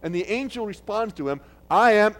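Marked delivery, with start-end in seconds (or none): 1.7-2.2 loud, commanding angel's announcement. Acted. Angel Gabriel announcing himself to Zechariah, loud and commanding. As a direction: boom out the angel's first words. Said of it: "He had to say this in a loud voice".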